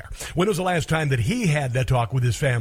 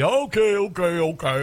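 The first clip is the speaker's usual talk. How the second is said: mock reassurance; 3 semitones higher than usual. Mocking Democrats' dismissive reassurance.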